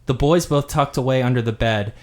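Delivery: ordinary delivery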